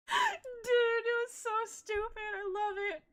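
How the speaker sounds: high and teary through laughter; cry-laughing at a silly movie scene; laughing to tears. Real, not acted. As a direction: squeak it out while crying with laughter. Laughing-crying after joke.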